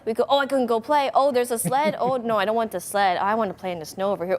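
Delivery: usual speaking voice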